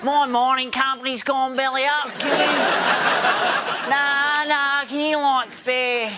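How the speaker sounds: drawn-out, strung-out junkie plea; begging junkie. Acted. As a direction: wheedle like a spaced-out addict cadging money. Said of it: "turn all our business leaders into junkies".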